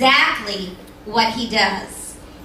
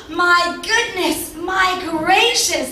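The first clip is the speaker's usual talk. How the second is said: amazed cry; 5 semitones higher, slower than usual. Acted. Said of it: "they shouted".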